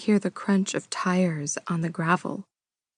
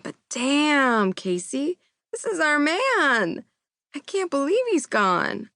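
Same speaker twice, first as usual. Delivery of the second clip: a touch of high-pitched grief; grieving cohost; 8 semitones higher than usual. Acted. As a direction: mourn him aloud on air.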